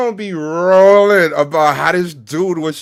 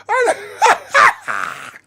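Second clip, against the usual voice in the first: 1 howling laugh; 8 semitones higher than usual. Real, not acted. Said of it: "I'm crying tears, bro".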